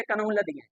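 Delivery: कनौलदियाँ with every written letter sounded; spelling read literally as कनौलदियाँ, "ka-naul-a-diya"; childhood misreading. Retold or imitated. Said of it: "बचपन में पढ़ते थे".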